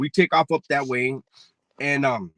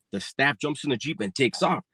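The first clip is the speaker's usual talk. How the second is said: ordinary talk, nothing marked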